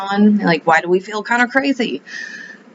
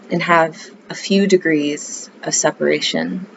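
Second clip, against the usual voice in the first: calm yoga-teacher voice; guided meditation. Acted. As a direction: ease the listener into the breath, unhurried.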